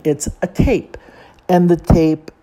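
ordinary delivery

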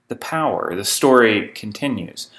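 ordinary talk, nothing marked

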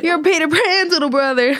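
high-pitched mock-excited recognition; teasing call-out. Teasing call-out to a friend's brother.

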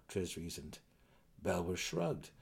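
plain reading voice

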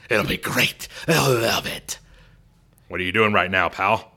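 loud, pushy promoter voice; Vince McMahon impression. Boss coaxing Stone Cold on the phone, pushy and loud. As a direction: sell it to him like a hammed-up boss. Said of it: "Vince McMahon just saying".

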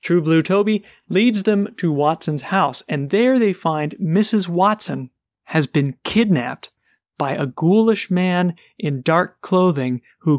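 ordinary delivery

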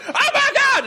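very high freak-out squeal; mock scream. Someone freaking out while filming on the street, mock scream. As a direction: imitate a panicked bystander screaming. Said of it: "Just, like, screaming out of the".

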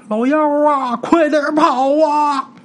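high, slow, drawn-out cry to flee; desperate shout. A narrator's reading. Old father screaming at his son to run. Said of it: "然后老周就喊呐".

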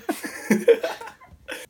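very high, airy giggle; helpless high laugh at own failed attempt. Real, not acted. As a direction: burst into high-pitched giggles.

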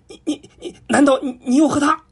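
stunned monkey stammering his question; shocked stammer. A narrator's reading. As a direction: choke on the words in disbelief. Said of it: "吃惊地问".

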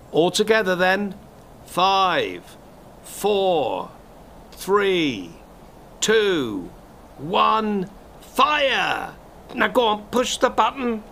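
put-on presenter whipping up a young viewer to push the button, with high excited calls; excited countdown. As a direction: count down like the big moment is here, building up to a high shouted "fire". Leads countdown to the blast.

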